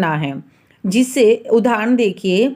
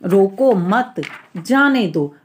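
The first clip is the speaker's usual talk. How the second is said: "रोको मत", held and cut off, then "जाने दो"; pause after मत; 2 semitones lower, slower than usual. Shown as a demonstration. Teacher repeating the example sentence for students, pause after मत. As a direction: break firmly after मत before saying जाने दो. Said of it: "जहाँ जहाँ विराम देते हैं".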